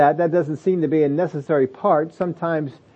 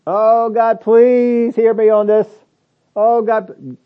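higher, louder, slow pleading "Oh, God"; mock begging prayer; 8 semitones higher, 5 dB louder, much slower than usual. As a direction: ham up a desperate beggar. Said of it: "There's no begging".

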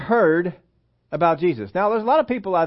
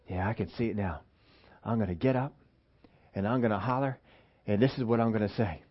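inner thoughts; 7 semitones lower, 9 dB quieter than usual. Acted. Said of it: "he's thinking".

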